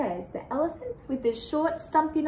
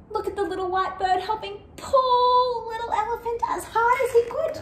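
straining excitement; 9 semitones higher, faster than usual. A narrator's reading. Narrator cheers on the tug-of-war.

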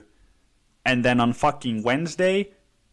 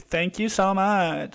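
mock celebrity gratitude; 6 semitones higher, slower than usual. Mocking celebrity acceptance speech.

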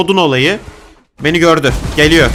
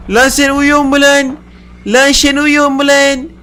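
high, loud, drawn-out heckle; stretched-out shouted taunt, pitched high; taunting yell; 9 semitones higher, 4 dB louder, much slower than usual. Real, not acted. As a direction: holler it slowly at a sleeper. Yelling at a sleeping game character.

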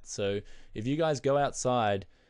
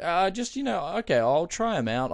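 higher, hesitant, slightly louder fumbling reply; awkward brush-off; 7 semitones higher, 5 dB louder than usual. Retold or imitated. You awkwardly brushing off the jealous friend, higher and unsure. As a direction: fob him off awkwardly, pitched up. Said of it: "and you're like".

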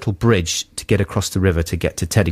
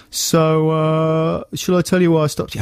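higher, condescending officer; smug cop voice; 7 semitones higher than usual. Retold or imitated. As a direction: savour every word like a self-satisfied cop. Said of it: "he's like".

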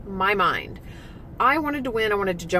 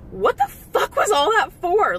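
exasperated outburst; 9 semitones higher, 3 dB louder than usual. Retold or imitated. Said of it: "you're literally like".

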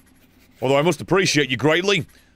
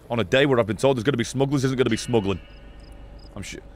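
normal talking voice

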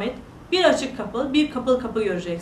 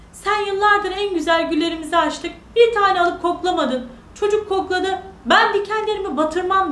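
higher-pitched, reproachful storybook rose; indignant rose; 7 semitones higher, 4 dB louder than usual. Acted. Rose reproaches the giant and refuses, indignant. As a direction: scold the giant in a high voice.

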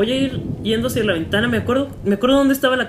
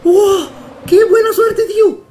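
much higher, loud, stretched out; excited shout; 9 semitones higher, 8 dB louder, much slower than usual. Real, not acted. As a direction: shout it, thrilled and relieved. Lucky escape.

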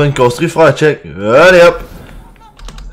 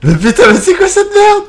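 high disbelieving shout; stunned outcry. Real, not acted. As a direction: cry out in disbelief. Stunned by one-shot pickaxe.

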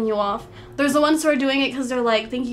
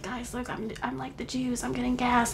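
much softer, offhand delivery; hushed retold aside; 9 dB quieter than usual. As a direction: drop the voice, as if slipping in a remark. Retold quiet dark joke in shower.

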